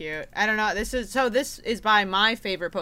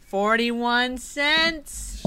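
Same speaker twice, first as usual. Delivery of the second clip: drawn-out, louder; slow stretched echo; 6 semitones higher, 6 dB louder, much slower than usual. Pretend. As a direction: stretch each word slowly.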